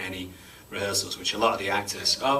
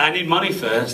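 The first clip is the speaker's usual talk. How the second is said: amused laughing quip; 9 semitones higher, 6 dB louder than usual. Real, not acted. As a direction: joke delivered through laughter. Joking refusal to perform accent.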